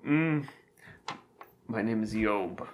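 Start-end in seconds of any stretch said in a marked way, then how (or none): 1.7-2.7 much lower, quiet voice for Job. Acted. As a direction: switch to the second character, low and soft. Playing second character.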